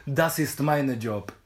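toothless Russian brawler. Retold or imitated. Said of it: "Die Stimme von der". (langsam, wie ein zahnloser Russe)

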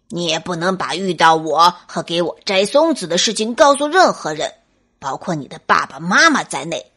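低沉凶狠的威胁; 像恶狼一样恐吓; 恶狠狠的大灰狼. Acted. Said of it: "大灰狼恶狠狠地说着". (恶狠狠地威胁)

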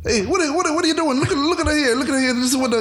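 high-pitched bad Italian accent; a hammy Italian cop voice. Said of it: "I don't know how to do an Italian accent".

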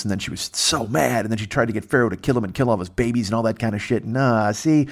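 ordinary talk, nothing marked

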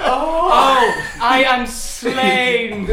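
loud, very slow stage death; milk every word of the death line; hammy stage actor. Pretend.

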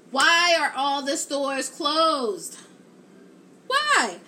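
slow, loud frustrated outcry; exasperated. Real, not acted. Shopper finding the mall stores closed, loud exasperation. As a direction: let the disbelief burst out. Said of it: "I was so mad".

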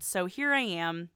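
normal talking voice

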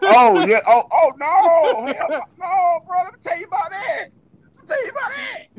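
high, laughing wail of disbelief; cracking up, voice climbing high; laughing outburst. Real, not acted. Laughing high to his friend about the late-night feast.

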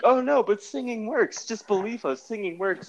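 high-pitched pleading character voice; mock pleading. Mocking the movie's characters begging to be believed, high-pitched pleading.

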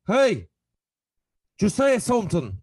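put-on high, loud drunken challenge; drunk guy. Drunk man in a bar picking a fight, high and loud. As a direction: goad him like a hammered local. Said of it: "he was hammered, and he goes".

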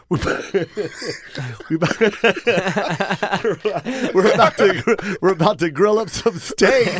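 giggling through the quoted words; laughing. Real, not acted. Laughs through quoting Russell.

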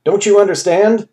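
high, urgent plea; pleading. A narrator's reading. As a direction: beg them to see it. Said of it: "he pleaded".

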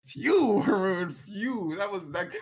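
a real laughing sigh of relief; relieved laughing phew. Real, not acted. GM relieved after tense scene.

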